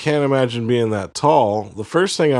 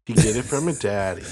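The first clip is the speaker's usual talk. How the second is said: put-on Boston accent. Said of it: "Boston accent".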